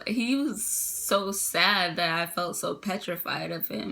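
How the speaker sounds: ordinary delivery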